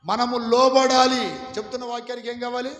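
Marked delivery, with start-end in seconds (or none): 0.0-1.3 slow, loud and higher, each syllable pressed; loud slow call of మనము లోబడాలి. Shown as a demonstration. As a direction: model the answer loudly for the crowd to repeat. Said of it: "గట్టిగా చెప్పండి".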